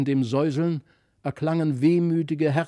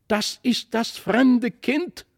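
high, slow cry of recognition; drawn-out, high exclamation; calling out; 8 semitones higher, 4 dB louder, slower than usual. A narrator's reading. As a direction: call it out as an amazed child. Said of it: "rief Christlieb".

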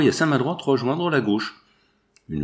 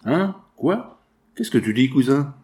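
stammering surprise; 2 semitones higher, 3 dB louder than usual. Acted.

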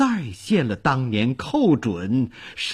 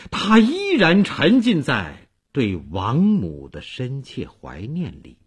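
ordinary delivery

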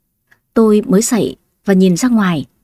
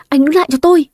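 shouting after him; 6 semitones higher, faster than usual. Acted. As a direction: yell after him as he runs. Chasing man with broom. (hét gọi to hơn, lên giọng ở cuối)

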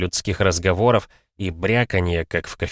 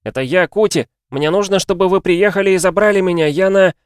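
put-on high, loud, urgent boy; shouting; 11 semitones higher, 6 dB louder than usual. A narrator's reading. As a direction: yell desperately down the phone to dad. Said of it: "закричал Кути". (отчаянно кричит в трубку)

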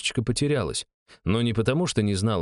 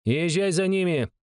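barking order; 10 semitones higher than usual. A narrator's reading. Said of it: "рявкнула она".

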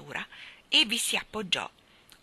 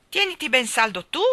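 loud, higher-pitched reply; shouted retort; 5 semitones higher, 7 dB louder than usual. Acted. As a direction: call back a warning of your own. Bird woman shouts back.

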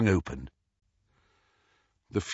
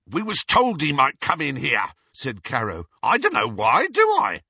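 defensive; 5 semitones higher, 5 dB louder than usual. A narrator's reading. Amycus defensive.

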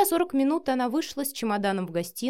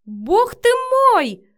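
loud high cry of shock; shocked exclamation; 11 dB louder, much slower than usual. A narrator's reading. Marina walks in on the video call, shocked cry. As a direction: exclaim it loudly, pitched right up.

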